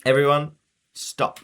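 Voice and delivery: high, loud stop order; sharp command. Acted. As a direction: bark it to halt everyone. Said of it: "Aunt Rosa spoke in a sharp voice".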